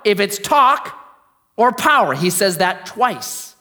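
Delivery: loud, high proclamation of scripture; forceful preaching. Real, not acted. Preacher quoting Paul to the congregation, forceful preaching. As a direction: proclaim it with weight on each phrase.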